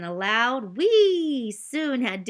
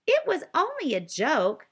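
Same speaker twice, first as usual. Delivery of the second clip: high, startled defence; surprised it hurt anyone; surprised protest; 8 semitones higher, 4 dB louder than usual. Acted. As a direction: caught off guard, insisting. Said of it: "said Digiduck in surprise".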